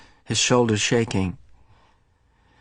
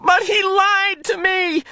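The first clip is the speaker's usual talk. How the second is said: high, slow, tearful cry; boy weeping at betrayal; slower than usual. Acted. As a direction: wail it through tears. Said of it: "Jonas wept".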